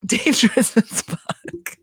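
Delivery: slow, broken up by laughter; laughing. Real, not acted. Podcaster cracking up over models on ladders, laughing. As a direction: crack up through the words. Cracks up at her own remark.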